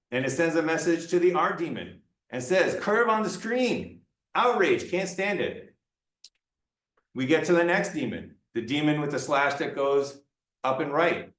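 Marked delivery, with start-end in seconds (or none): 2.8-5.7 high-pitched, melodramatic indignation; mock horror, each phrase swooping up; mock outrage. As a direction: play at being unable to bear it. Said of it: "gets very angry and outraged".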